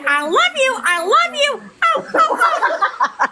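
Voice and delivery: high squealing fan voice; excited squeal. Real, not acted. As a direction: squeal it out, thrilled to see her. Fan greeting a friend excitedly.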